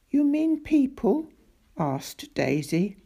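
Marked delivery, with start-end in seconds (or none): 0.1-1.3 put-on higher, girlish questioning voice; a curious young girl asking up; lightly voiced girl, raised pitch; Daisy's voice. A narrator's reading.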